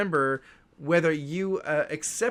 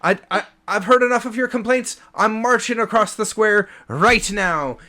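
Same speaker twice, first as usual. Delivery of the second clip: louder, fed-up declaration; huffy dwarf; 3 semitones higher, 6 dB louder than usual. Acted. Dwarf bartender fed up with the elf's complaints, huffy. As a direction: storm off announcing it.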